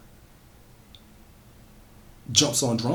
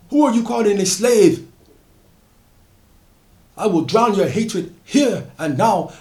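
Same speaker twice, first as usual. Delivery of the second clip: raised, furious declaration of vengeance; 7 semitones higher, 5 dB louder than usual. Acted. Solomon drowning the slave catcher, furious and raised. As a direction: roar it at the man you're drowning.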